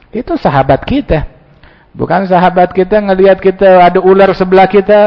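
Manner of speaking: usual speaking voice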